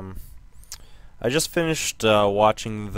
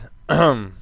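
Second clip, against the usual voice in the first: throat clearing; 3 semitones higher, 6 dB louder than usual. Real, not acted. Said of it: "Sorry, I'm losing my voice".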